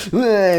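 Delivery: high squawked eh; attack cry. Acted. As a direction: squawk as if lunging to bite. Mangle lunges to bite.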